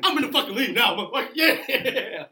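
loud, high victory yell breaking into laughter; triumphant shout. Real, not acted. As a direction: shout it like you've just won. Celebrates a correct answer.

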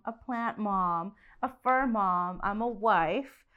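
normal talking voice

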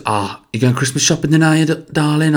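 high-pitched Nottingham chat; Nottingham hairdresser. Retold or imitated. Hairdresser asking about Christmas shopping, imitated Nottingham accent. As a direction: imitate a glam Nottingham mum, voice lifted. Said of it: "and she goes".

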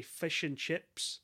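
slow New Zealand "fish and chips", fish said as "fush"; New Zealand accent. Shown as a demonstration. Podcaster demonstrating the test phrase for telling accents apart, New Zealand accent. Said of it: "that's New Zealand".

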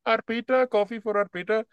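high sing-song name call; barista calling out. Acted. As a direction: call the order across the counter.

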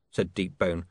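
ordinary delivery

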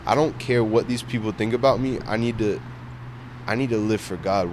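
normal talking voice

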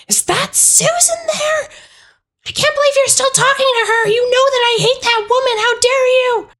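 very high, outraged phone tirade; screaming. Acted. Grandmother raging at nephew over the phone, screaming. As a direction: screech it in a high, scolding character voice. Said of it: "on the outside just screaming".

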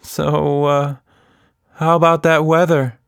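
awkward small talk under pressure; nervous. A narrator's reading. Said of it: "she shrugged nervously".

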